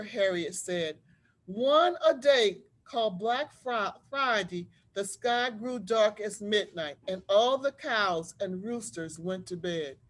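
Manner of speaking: put-on lively read-aloud voice for children; story narration. A narrator's reading. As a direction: tell it like a storybook to a class. Teacher reads picture book aloud.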